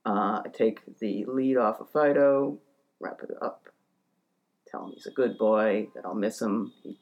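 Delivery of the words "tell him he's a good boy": usual speaking voice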